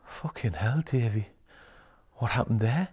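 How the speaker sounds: quietly quoting an amazed client. Retold or imitated. Coach quoting a stunned client after a session, hushed. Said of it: "and they're like".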